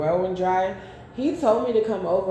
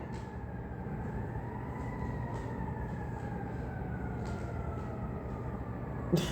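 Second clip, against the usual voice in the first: silent wheezing laugh; 14 dB quieter than usual. Real, not acted. Laughing at a card.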